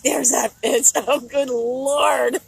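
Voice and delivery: laughing while voicing his groan; imitating him. Voicing the man's disgust at her return, mock grumble. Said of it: "He's like".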